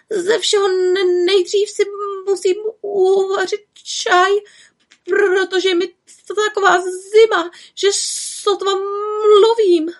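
high little witch voice, stammering through chattering teeth. A narrator's reading. Little witch frozen from her flight, stammering with cold. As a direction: shiver out every word, barely able to speak. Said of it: "jen drkotala zuby".

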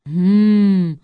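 loud, grumbled hum; grunted hum. A narrator's reading. Slavic giant detective takes up the reward offer, grunting. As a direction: grumble assent through closed lips. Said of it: "zabručel Jakolev".

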